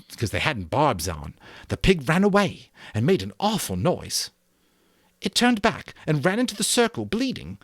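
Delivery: high and loud, tumbling quickly; Jack's eager boast. A narrator's reading. Jack breaking in to tell the hunt, high and loud. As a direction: rush the story out excitedly. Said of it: "He broke in quickly".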